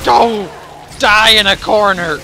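loud angry yell at monster; furious shout. Real, not acted. Fighting, yelling at enemy.

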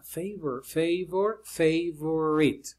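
"favor, favor" en inglés, luego "favorite" muy lento; pronunciación de favorite. Shown as a demonstration. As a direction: repite "favor" con "fei" y alarga "favorite". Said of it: "para que miren cómo se pronuncia la palabra". ("favor" y "favorite" pronunciados a la inglesa, pausadamente)

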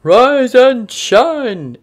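big slow booming wake-up call; Santa's voice calls the sleeping penguin awake; loud call. Acted. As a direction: boom it out to wake him. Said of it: "it cried".

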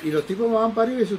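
usual speaking voice

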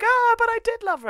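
very high, loud falsetto lament; hysterical wail. Retold or imitated. Yuki running off in a hammed-up high wail. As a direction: shriek the regret like a melodrama.

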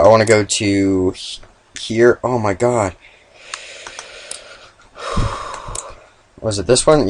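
usual speaking voice

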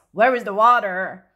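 lightly rasped creature question, loud; small raspy voice. A narrator's reading. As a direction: rasp it out like a baby creature. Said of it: "said in a small, raspy voice".

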